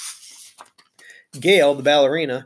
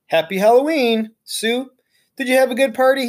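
higher, brighter man's greeting; cheerful greeting; 8 semitones higher, 3 dB louder than usual. Acted. Mister Morales greeting Sue after the party. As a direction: greet her warmly.

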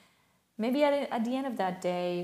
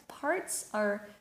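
ordinary delivery

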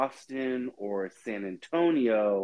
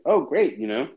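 retold excitement; 3 semitones higher, 7 dB louder than usual. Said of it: "I was so excited 'cause I'm like".

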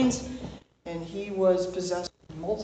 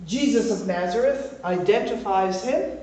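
ordinary talk

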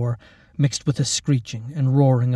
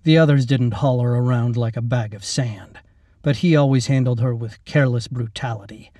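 usual speaking voice

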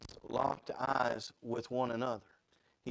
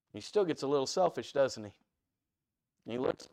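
normal talking voice

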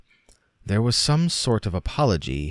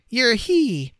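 put-on much higher, louder gleeful child; playful child; 5 dB louder than usual. A narrator's reading. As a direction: sing it out like a child at play. Gip starting the game.

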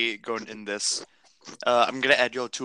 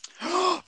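sharp high gasp of delight; excited gasp. Real, not acted. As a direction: gasp like you found treasure. Excited about Fritos.

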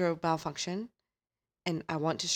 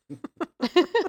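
loud laugh; 11 semitones higher, 9 dB louder than usual. Real, not acted. Laughing at friend's wild quote.

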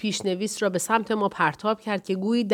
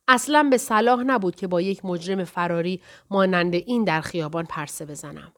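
usual speaking voice

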